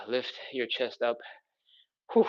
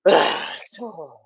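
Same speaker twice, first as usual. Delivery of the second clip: drawn-out, slow, louder exhale; 5 semitones higher, 7 dB louder, much slower than usual. Shown as a demonstration. Instructor leading the breath for viewers, a slow drawn exhale. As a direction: stretch the word out like the breath itself.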